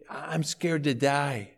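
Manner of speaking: soft, slow, hushed fearful words; retold whisper. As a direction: hush it the way a frightened old woman would. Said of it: "she whispered".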